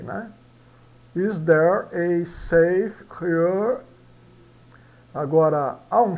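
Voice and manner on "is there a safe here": very slow, stressed "there", rising end; slow model sentence. Shown as a demonstration. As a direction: drill "Is there a safe here?" slowly. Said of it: "o A da E".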